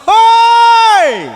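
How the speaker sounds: very high, loud calling shout. Retold or imitated. Hailing someone far off in the hills, full shout. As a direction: call out to someone across the valley. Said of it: "harus berteriak".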